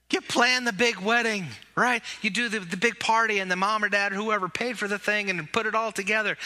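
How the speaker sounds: ordinary delivery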